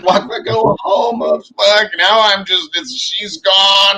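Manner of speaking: loud, high, drawn-out lament; hammed-up wail of being left behind; mock despair. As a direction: wail it slowly, exaggerating the misery. Hammed-up despair as partner leaves.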